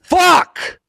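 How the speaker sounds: high, loud exploding curse; anguished shout. Acted. OCD dog sitter learns it's a hundred one, anguished shout. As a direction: explode in frustration.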